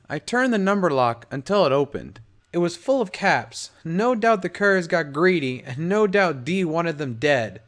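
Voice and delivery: usual speaking voice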